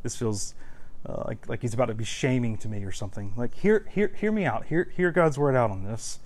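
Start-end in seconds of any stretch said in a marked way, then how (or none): 0.0-3.3 soft, fast, anxious mumble; a worried listener muttering to himself; nervous listener. Retold or imitated. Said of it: "if you're squirming in your seat and like".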